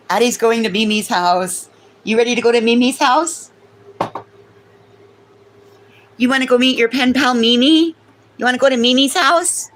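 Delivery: put-on high, sing-song baby talk to a dog; pet baby talk. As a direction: coo at the puppy.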